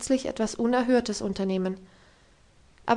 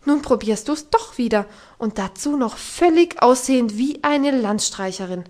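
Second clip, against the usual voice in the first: loud, scolding tirade; angry outburst; 7 dB louder than usual. Acted. Governess scolding Heidi for wandering off, loud angry outburst. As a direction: tear into the girl, loud and sharp. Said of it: "Jetzt brach sie los".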